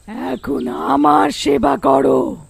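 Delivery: slow, emphatic shouted order; villainous command. Acted. Witch ordering her captives to serve, commanding shout. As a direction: stretch each word into a loud command.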